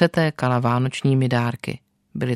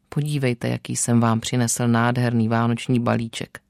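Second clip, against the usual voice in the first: ordinary delivery, nothing marked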